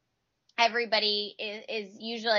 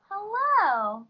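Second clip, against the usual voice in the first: bright high greeting called across the house; calling out hello; 11 semitones higher than usual. Real, not acted. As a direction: sing out hello to someone walking in. Greets husband arriving home.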